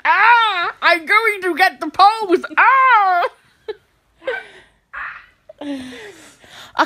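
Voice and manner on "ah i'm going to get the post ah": put-on strained cries, thick Austrian accent; Arnold Schwarzenegger. Fitting the post into an actor impression, strained Austrian accent. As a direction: groan and bellow like an action hero. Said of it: "Arnold Schwarzenegger do that".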